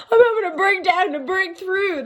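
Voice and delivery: high, wailing joke meltdown; mock breakdown. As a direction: ham up a tearful collapse, pitch way up. Jokes about having a breakdown.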